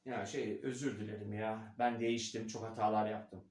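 low, subdued apology; apologetic person. Acted. Someone comes to the coach apologising, low and subdued. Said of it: "Birisi geliyor bana".

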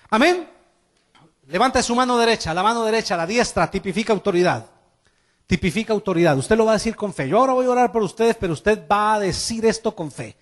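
fast loud rally call to stand and pray; rousing preaching. Acted. Pastor calling the congregation to stand, loud and rousing. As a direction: fire the church up to stand.

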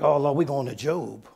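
low, grumbled congregation aside; mock dread. Congregation reacts to turning to Job, low mock dread. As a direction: grumble it low, imitating a reluctant listener.

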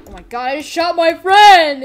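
loud, high mock panic; hammed-up shock at your own deed; horrified outcry. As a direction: cry out in pretend horror. Character horrified at shooting his friend.

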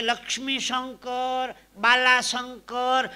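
drawn-out slow names, each stretched; slow spelled-out names. Retold or imitated. Man drawing out long names to justify sign. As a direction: stretch each name out slowly and loud.

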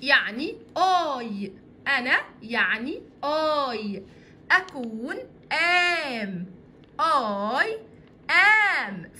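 very slow, spelled-out gloss; walk through it word by word, slowly; teacher slowly glossing I and am; slow explanation. Shown as a demonstration. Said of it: "I am دي أساسها إيه؟".

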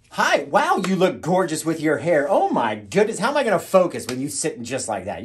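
loud, high hammed-up greeting, easing off at the end; gushing flattery. Host flattering the viewer in an intro take, gushing. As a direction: gush over the viewer like a showman.

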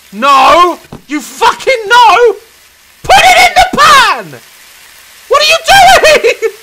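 high, loud enraged shrieks; furious screaming. Real, not acted. Gamer screaming at the cooking game, furious. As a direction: scream at the clumsy hand in rage.

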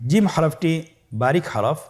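ordinary talk

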